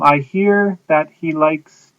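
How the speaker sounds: I hear that he likes, slow and deliberate; slow teaching read. Shown as a demonstration. Teacher models a sentence to students, slowly. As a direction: spell the sentence out word by word. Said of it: "So you'd say".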